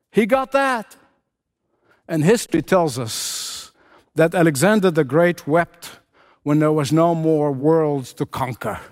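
ordinary delivery

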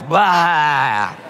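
retching blah sound; mock retch; mock vomiting blah. Shown as a demonstration. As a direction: spit it out like throwing up. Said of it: "spiritually vomiting".

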